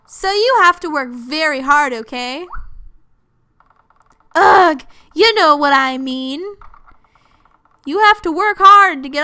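emphatic, scolding game character; put-on bossy character read. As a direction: voice someone handing down a tough job.

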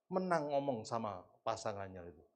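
ordinary talk, nothing marked

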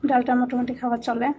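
chewing while talking; speaking around food; mouth full. Real, not acted. Seen 0: bite at -9, lips pressed, cheeks full during words.

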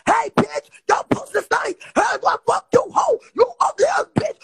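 high, loud mocking rant as Eric; mock Eric yelling. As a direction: shriek insults like a petty Eric. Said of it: "if you was to pull Eric up right now".

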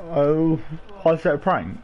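normal talking voice

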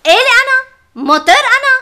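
loud offended demand; indignant outburst. Acted. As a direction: explode at him, offended. Wife erupts at husband.

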